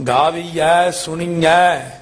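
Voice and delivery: slow ਗਾਵੀਐ, ਸੁਣੀਐ with open ai endings; drawn-out ai endings. Shown as a demonstration. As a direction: stretch the final ai of ਗਾਵੀਐ and ਸੁਣੀਐ. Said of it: "ਇੱਥੇ ਵੀ ਦਲਾਈਆਂ ਨੇ".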